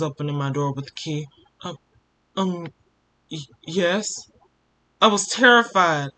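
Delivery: plain reading voice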